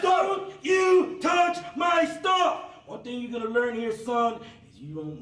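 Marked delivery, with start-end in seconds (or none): 0.0-2.6 barked orders at full volume; drill sergeant shouting. Real, not acted. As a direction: yell it like a drill instructor. Drill instructor yelling.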